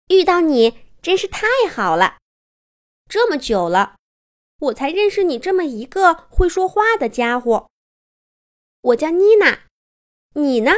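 a hint of an excited bunny voice; delighted toy rabbit. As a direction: introduce yourself eagerly as a little bunny.

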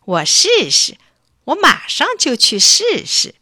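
put-on excited boy, loud and climbing high; eager. A narrator's reading. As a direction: burst out, keen to try it at once. Boy delighted to try magic word. (兴奋地跃跃欲试)

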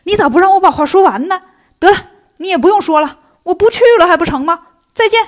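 hot-tempered, acted shouting back; fed-up outburst. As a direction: blow up at being nagged and quit on the spot. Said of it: "火大了".

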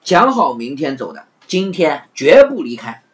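serious. Acted. Afanti refusing to leave, mock serious. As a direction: state it like a point of honour. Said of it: "一脸严肃地说".

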